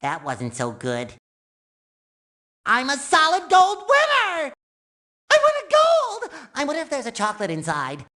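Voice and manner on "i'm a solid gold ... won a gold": high, exultant winner's cry; triumphant shout. Acted. Sponge who won a gold, triumphant shout. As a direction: shout the victory.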